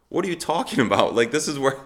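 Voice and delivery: high, quick, incredulous; can't believe what he's hearing; baffled protest. Retold or imitated. Answering the pastor, high and fast in disbelief.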